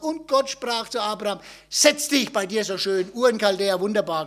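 brisk parody of God's words; mock cosy misquote. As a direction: toss out the invented verse chattily. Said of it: "Er hat nicht gesagt".

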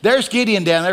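ordinary talk

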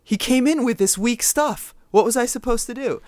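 high, loud mocking imitation; mocking husband. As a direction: mimic him with a high voice. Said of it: "You said".

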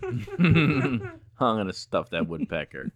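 slow, laughing delivery; can barely get the words out for laughing; real giggles breaking the line; laughing through it. Real, not acted.

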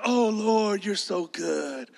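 soft, drawn-out sigh of adoration, imitated; mock gentle praise. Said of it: "it's not like the rocks will be like".

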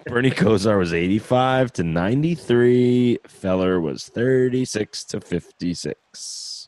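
drawn-out, deliberate repeat of the years. Real, not acted. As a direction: spell out the dates slowly, stretching the numbers. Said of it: "Just slower".

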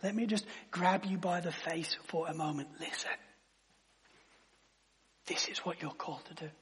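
soft, close, pleading near-whisper; intimate hush. Acted. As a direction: lean in and plead gently. Pastor pleads intimately with congregation.

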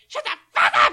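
high, rough retold outburst; mock yell. Mom swearing at the dog, mock yell. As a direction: yell it like scolding a noisy dog.

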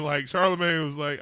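ordinary talk, nothing marked

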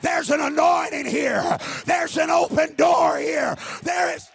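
slow, loud, rising shouts; climactic preaching. Acted. Pastor declaring revival over his church, slow shouting.

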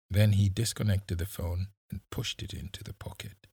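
ordinary delivery

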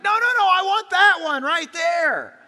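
put-on high, loud, slowed childish protest; whiny protest. Adult imitating a child demanding the lost item, high loud whine. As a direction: whine like a child wanting it back. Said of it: "You're like".

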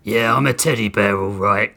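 forced through clenched teeth; gritted teeth. Acted. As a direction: grind the words out through teeth. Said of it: "Grissom said through gritted teeth".

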